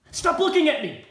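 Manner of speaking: loud, high outburst at a starer; sudden exasperated yell; angry shout. Acted. Repeated demand, escalating to a shout.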